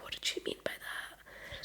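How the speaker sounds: hushed puzzled whisper; whispered wondering. Retold or imitated. Puzzling over Chloe's words all night, baffled whisper. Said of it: "the whole night, I was like".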